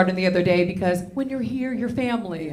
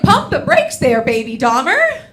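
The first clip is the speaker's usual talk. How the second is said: loud, laughing mock scold; 3 semitones higher, 7 dB louder than usual. Mom scolding her son over the bitten thumb, loud and laughing.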